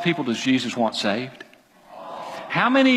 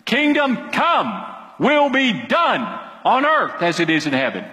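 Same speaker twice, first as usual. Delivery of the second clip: emphatic command; 5 semitones higher than usual. Shown as a demonstration. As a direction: pray it as a string of orders. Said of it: "it's just a command".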